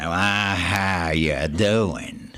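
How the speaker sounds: very slow, stretched-out Texan drawl. Retold or imitated. Texan friend calls to check on him, exaggerated Texan drawl. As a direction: imitate a Texan friend, dragging out each word. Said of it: "he's got that Texan drawl".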